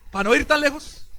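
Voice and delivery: usual speaking voice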